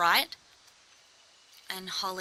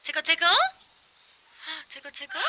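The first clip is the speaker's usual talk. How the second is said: giggly tickle attack; 8 semitones higher, much faster than usual. Real, not acted. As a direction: tease the bird with a tickle. Tickling the bird.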